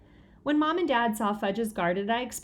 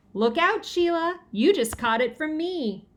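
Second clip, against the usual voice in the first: excited; 6 semitones higher, 3 dB louder than usual. A narrator's reading. Pete tags Sheila playfully.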